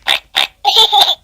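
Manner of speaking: put-on squeaky toddler laugh; high delighted giggle. A narrator's reading. George giggling over his new toy. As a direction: giggle like a happy piglet.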